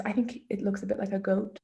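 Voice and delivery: ordinary delivery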